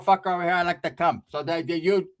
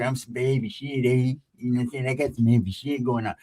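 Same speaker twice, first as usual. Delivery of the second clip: gangster talk; 5 semitones lower than usual. Retold or imitated. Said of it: "he talking like a gangster".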